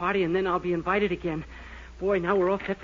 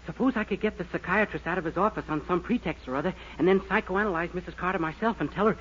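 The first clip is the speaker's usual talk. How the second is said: ordinary delivery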